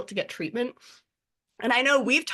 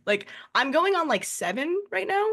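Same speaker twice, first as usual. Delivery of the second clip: ordinary talk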